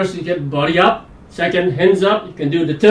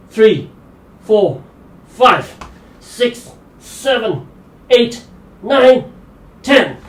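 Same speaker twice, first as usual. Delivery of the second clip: straining count; 5 semitones higher, much slower than usual. Real, not acted. Straining through plank count.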